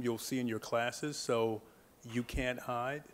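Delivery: normal talking voice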